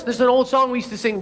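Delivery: ordinary talk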